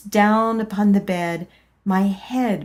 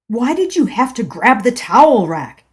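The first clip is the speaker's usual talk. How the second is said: louder, scolding yell; husband yelling; 4 semitones higher, 8 dB louder than usual. Acted. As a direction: snap at her angrily. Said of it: "he had yelled".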